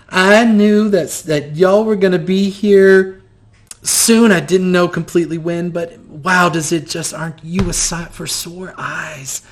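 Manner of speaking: a kindly grandmother greets the travellers, high and sweet; grandmotherly old woman. Acted. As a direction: coo like a delighted grandma.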